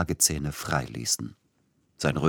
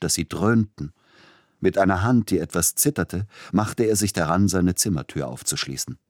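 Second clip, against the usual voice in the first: ordinary delivery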